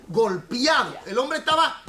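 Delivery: ordinary delivery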